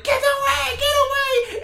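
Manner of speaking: high, loud shrieking yell; frantic shout. Retold or imitated. Retelling the garage scene to a friend, high frantic shout. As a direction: shoo the kids off in a shrill panic. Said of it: "is like".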